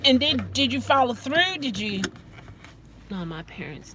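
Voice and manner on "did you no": usual speaking voice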